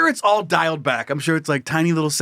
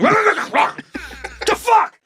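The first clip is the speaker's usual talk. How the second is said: mock barking; 10 semitones higher than usual. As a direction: bark it out like an angry dog. Dog barking at actor.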